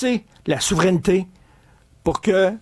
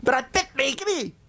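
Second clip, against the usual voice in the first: excited wife; 6 semitones higher than usual. Retold or imitated. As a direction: gush like a dazzled wife. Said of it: "puis ils disent".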